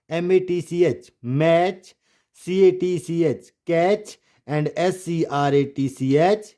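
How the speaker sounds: ordinary talk, nothing marked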